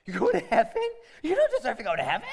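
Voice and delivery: high, loud, incredulous outburst; hammed-up outraged disbelief; mock indignation. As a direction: challenge them as if scandalised. Acts out scandalised reply to believer.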